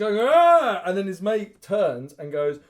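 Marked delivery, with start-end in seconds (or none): 0.2-0.8 very high, loud monster yell; explosive mock battle roar; imitated attack roar. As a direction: roar as you lunge.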